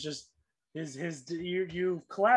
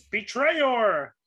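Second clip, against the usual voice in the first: loud, raised-pitch creature cry; screamed accusation; 5 semitones higher, 7 dB louder than usual. Acted. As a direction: scream it out as a betrayed monster. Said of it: "as one of them screams".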